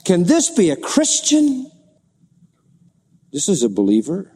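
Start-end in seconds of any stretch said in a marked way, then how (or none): 0.0-1.7 disbelieving question. Acted. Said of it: "And you say to yourself".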